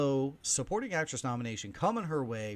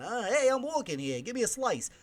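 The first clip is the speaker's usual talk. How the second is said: light, mock 1950s New York accent, a bit higher; 8 semitones higher than usual. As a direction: toss off a hammed-up old New York street guy. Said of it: "your impression of a nineteen fifties New York accent".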